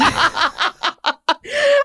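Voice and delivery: high delighted laugh; burst of laughter. Real, not acted. Friend teases about salad habit.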